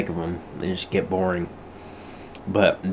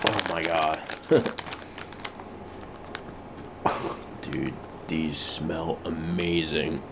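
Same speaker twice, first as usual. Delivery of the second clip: hushed awe; 3 semitones lower, 9 dB quieter, slower than usual. Real, not acted. First sniff of the chips.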